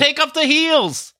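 high, slow, exasperated voice; mock exasperation. Host ranting at a woman wearing makeup at home, high and exasperated. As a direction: scold someone for dressing up at home.

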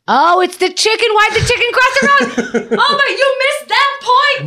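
very high, squealed discovery; excited realisation. Real, not acted. She suddenly gets the chicken joke, high excited squeal. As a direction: burst out with it at the top of the voice.

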